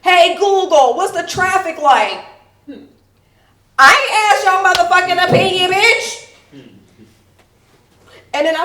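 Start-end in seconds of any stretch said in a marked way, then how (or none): 0.0-6.3 high, shouted commands; loud indignant rant. Acted. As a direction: yell at the smart speaker, building to outrage. Acted commands and outburst at Google.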